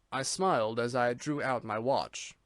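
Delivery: usual speaking voice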